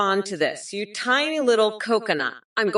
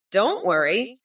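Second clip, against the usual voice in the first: ordinary talk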